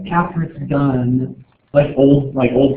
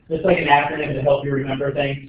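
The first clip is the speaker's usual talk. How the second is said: usual speaking voice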